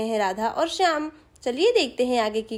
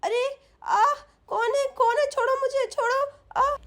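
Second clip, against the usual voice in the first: very high, whimpering protest; frightened mother; 9 semitones higher than usual. Acted. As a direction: cry out weakly as if seized. Mother is attacked by the thief.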